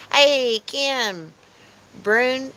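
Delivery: ordinary delivery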